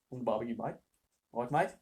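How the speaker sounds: tentative, quiet Australian accent; Australian accent attempt. Shown as a demonstration. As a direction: try out an Australian twang softly. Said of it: "loud, Australian chewing gum".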